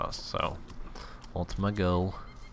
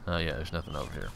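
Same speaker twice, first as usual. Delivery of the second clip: normal talking voice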